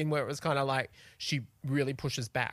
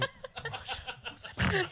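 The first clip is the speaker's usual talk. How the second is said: confused colleague; 13 semitones higher, 4 dB quieter, slower than usual. Retold or imitated. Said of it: "Stephen Peacock going".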